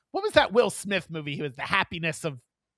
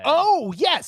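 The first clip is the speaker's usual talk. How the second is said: sudden high gasp of remembering, much higher and louder; oh yes, it just came back to her; gasping realization; 10 semitones higher, 8 dB louder than usual. Real, not acted. S0 remembering the phone call, gasping surprise.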